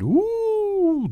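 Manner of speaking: very high, long-drawn "ooh"; whistling awe. A narrator's reading. Boy hears of friend's grand invitation, mock-impressed whoop. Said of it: "کامرون سود کشید".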